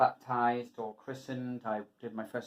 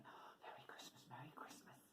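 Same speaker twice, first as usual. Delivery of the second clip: whispered greeting; 24 dB quieter than usual. Retold or imitated. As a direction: imitate parents in a hushed whisper. Parents returning from midnight mass, imitated.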